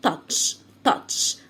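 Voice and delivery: touch, the ch said as a breathy تش; whispered ch sound. Shown as a demonstration. Teacher showing the ch sound in touch, unvoiced. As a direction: breathe touch out, ending on tch. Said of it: "السي اتش بنطقها تش".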